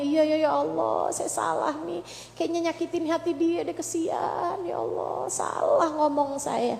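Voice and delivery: soft, hushed remorseful confession; mock remorse. Mother imitating remorse over hurting her child, softly and hushed. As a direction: murmur it like a guilty mother.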